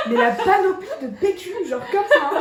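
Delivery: laughing hard while telling it; giggling through the words. Real, not acted. Laughing as she tells her friend about the toilet paper.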